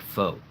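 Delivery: "faux", an open "foh" for four; no R at all. Shown as a demonstration. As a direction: say four as "faux", without any R. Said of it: "it's more like".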